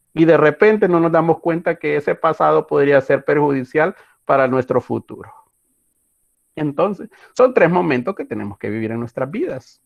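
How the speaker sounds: ordinary talk, nothing marked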